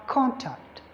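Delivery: CON stressed in "contact", second syllable lighter; stress on first syllable. Shown as a demonstration. Teacher giving the noun form, CON stressed in "contact". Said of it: "when it is used as a noun".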